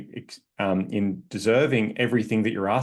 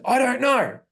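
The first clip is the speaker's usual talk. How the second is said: aggressive man; 13 semitones higher, slower than usual. Shown as a demonstration. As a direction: bark it out with big energy. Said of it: "But the tonality".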